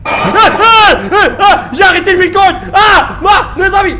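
high, loud scared yelps; scared horror game streamer. Real, not acted. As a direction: yelp in fright at the monster. Monster scares him. (streamer effrayé qui crie)

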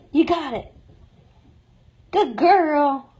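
ordinary talk

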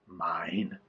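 soft, low guilty answer; guilty admission. A narrator's reading. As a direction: own up under your breath. Jonah confesses guilt to sailors.

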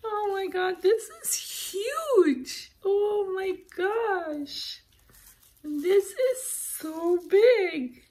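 high-pitched, drawn-out squeal of amazement; real squealing delight. Real, not acted. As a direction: gush slowly, almost crying with delight. Delighted at a huge gift.

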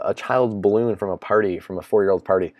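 usual speaking voice

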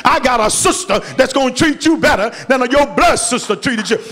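ordinary talk, nothing marked

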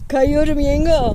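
high, loud laughing call. Real, not acted. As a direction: yell it up to her aunt, half laughing. Calling to aunt while slipping.

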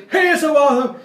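hyped vlogger. Pretend. Mimicking a hyped YouTuber.

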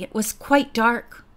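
usual speaking voice

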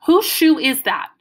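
put-on stern little girl snapping; mean demand. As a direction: she demands an answer, hard and slow. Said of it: "She was sounding real, real mean".

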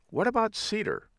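high-pitched retold eureka; sudden bright idea. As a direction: act out hitting on the perfect name. Couple hit on name together.

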